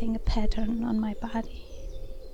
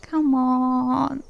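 drawn-out, louder plea; begging; 5 dB louder than usual. Acted. Woman urging a reluctant lover on, begging. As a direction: coax and beg, stretching the words. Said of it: "keep on begging".

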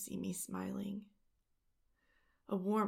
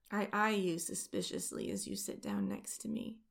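ordinary delivery

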